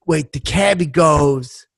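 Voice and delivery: ordinary talk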